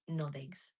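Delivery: ordinary talk, nothing marked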